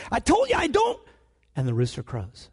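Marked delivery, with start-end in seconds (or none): 0.1-1.0 mock cursing denial. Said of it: "he's cursing".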